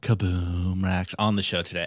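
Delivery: playfully dropped very low; mock announcer drone. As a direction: sink way down on the sponsor name. Repeats the sponsor name jokingly.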